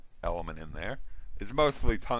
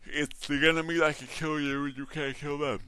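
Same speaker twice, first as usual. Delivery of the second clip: yawning; 6 semitones higher, 4 dB louder than usual. Real, not acted. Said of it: "I'm sorry if you didn't hear that, 'cause I yawned".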